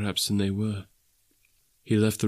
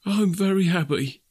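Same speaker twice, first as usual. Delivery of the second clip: higher, earnest declaration; moved, happy father; 9 semitones higher than usual. Acted. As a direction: say it with feeling in a raised pitch. Bob moved by family's promise.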